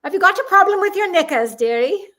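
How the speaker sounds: put-on high, loud, quick British accent; cheeky British impression, pitched well up. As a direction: put on an exaggerated British busybody voice. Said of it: "in a very British accent".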